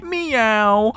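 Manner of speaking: high-pitched put-on cat meow; cutesy meow. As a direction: mew it like a coy kitten. Reads out a cat-like greeting.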